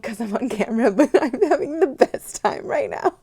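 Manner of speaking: laughing, bubbling over with real excitement; can barely hold the joy in; excited laugh-talk; giddy excitement. Real, not acted. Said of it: "I'm really trying to contain my excitement".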